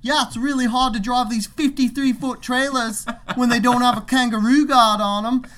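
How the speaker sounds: loud, high, hammed-up Aussie; mock Australian accent. Worker mocking trucker to coworker, exaggerated Australian accent. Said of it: "we're making fun of his accent".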